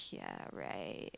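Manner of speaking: very low, soft, dubious aside; quiet, doubting brush-off; sceptical. Retold or imitated. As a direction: mutter it in disbelief. Retells her sceptical reply.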